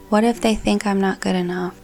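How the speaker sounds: usual speaking voice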